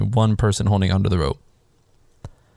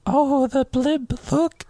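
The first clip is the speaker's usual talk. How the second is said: put-on very high, slow, drawn-out old lady voice; mock old lady marveling at the TV; much slower than usual. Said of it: "the younger old lady turns on the T V and she's like".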